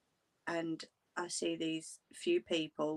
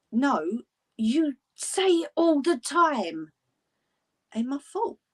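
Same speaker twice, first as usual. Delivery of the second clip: high, loud acted-out protest; retold quarrel, pitched up and emphatic; insistent retort; 8 semitones higher, 7 dB louder, slower than usual. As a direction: throw the blame back, voice raised. Acting out quarrel over blame.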